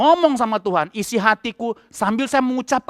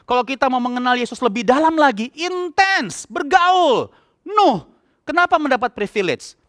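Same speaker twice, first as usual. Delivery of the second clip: high-pitched fervent preaching; 5 semitones higher than usual. Acted. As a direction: urge the congregation with full conviction. Preaching at full force. (khotbah berapi-api dengan nada tinggi)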